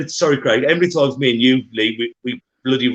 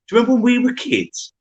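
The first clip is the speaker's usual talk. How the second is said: excited; 11 semitones higher, 3 dB louder than usual. Real, not acted. Sudden nostalgic turn to co-hosts.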